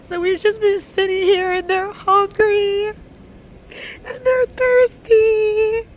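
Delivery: real high, loud wail, words stretched out; crying in distress. Real, not acted. Pleading for kids stuck hungry in the ER, crying loudly. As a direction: break down crying as you plead. Breaking down over sick waiting children.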